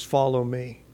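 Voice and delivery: the Lord softly inviting Peter to follow; gentle call. Retold or imitated. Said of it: "The Lord says".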